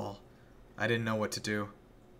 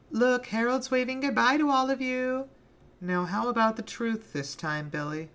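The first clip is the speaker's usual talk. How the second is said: put-on a sadistic girl toying with her victim; Penny taunting Billy before the crowd; Penny's mock-sweet voice; 13 semitones higher, 5 dB louder than usual.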